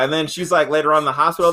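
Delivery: usual speaking voice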